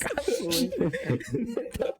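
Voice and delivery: high-pitched, helpless laughter; real laugh running through the words. Real, not acted. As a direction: blurt it out while cracking up. Laughing over the crash word.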